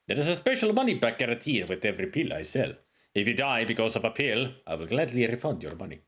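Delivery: lightly put-on Middle Eastern merchant accent; a hint of a Middle Eastern accent on a salesman. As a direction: sell it like a Middle Eastern bazaar trader. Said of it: "Middle Eastern leads to lesser rolling R's".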